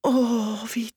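high, sighing regret; rueful. A narrator's reading. Alice realizes her blunder, rueful and dismayed. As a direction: sink into dismayed self-reproach. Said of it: "sagte Alice in reumütigem Tone".

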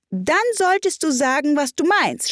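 high-pitched, reproving hare; March Hare. A narrator's reading. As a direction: lecture in a high character voice.

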